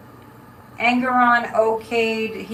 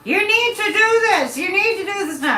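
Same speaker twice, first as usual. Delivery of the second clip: high, pushy, hurried order; bossy demand; 9 semitones higher, 5 dB louder than usual. Retold or imitated. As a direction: bark it like a pushy nag. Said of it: "I've had people before go".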